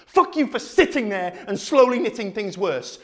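high-pitched, building rage; furious tirade. Acted. Speaker blaming the watching audience, high furious tirade.

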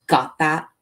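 a contained shout. A narrator's reading. Young cop warning a prisoner, mildly shouted. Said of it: "he shouts".

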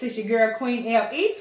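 ordinary delivery

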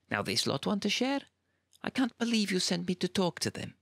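incredulous. A narrator's reading. Incredulous complaint.

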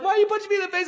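high, outraged squeal of a victim; shocked bystander. Acted. Stranger yelling at the dad, high and shocked. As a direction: whine it like someone just got hit.

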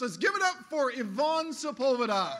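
loud, rousing introduction; announcer hype. Real, not acted. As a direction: pump up the crowd for her. Introducing the next speaker.

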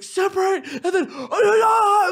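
high loud shout to the crowd; hammed-up stage shout; mock frontman yell. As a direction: yell it to split the pit. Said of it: "they'll do this".

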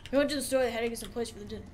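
imitating a brother, very fast and run together; rapid slurred brother impression; brother's Boomhauer-like mumble. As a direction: race through it, words blurring like Boomhauer. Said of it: "do an impression of my brother".

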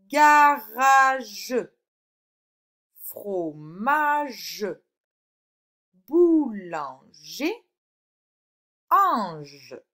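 loud, drawn-out syllables. Shown as a demonstration. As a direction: stretch each word so every sound shows. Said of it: "dans quelle syllabe tu entends". (syllabes lentes et appuyées)

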